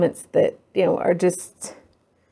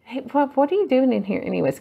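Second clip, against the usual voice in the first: lightly voiced husband, quick and a bit higher; husband's question; 5 semitones higher than usual. Husband walking in on her resting, lightly voiced puzzled question. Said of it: "He's like".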